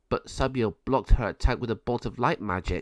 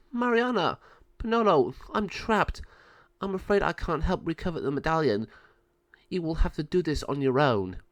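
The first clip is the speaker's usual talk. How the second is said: higher, urgent then resigned; trapped wise sister; 6 semitones higher than usual. Acted. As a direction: pass on the burden from the trap. Trapped Sabio instructs birds.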